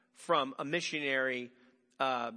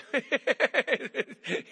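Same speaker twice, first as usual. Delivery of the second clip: a burst of real laughter; short laugh; 10 semitones higher, 8 dB louder than usual. Real, not acted. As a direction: laugh at the silent room. Laughs after quiet audience.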